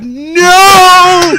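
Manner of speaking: high, loud shocked cry; shout. Retold or imitated. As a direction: yell it in disbelief.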